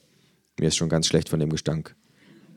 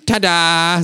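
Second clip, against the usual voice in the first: much higher, louder ta-da; triumphant kangaroo; 12 semitones higher, 8 dB louder than usual. Acted. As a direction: announce it like a magic trick. Känguru presenting glasses.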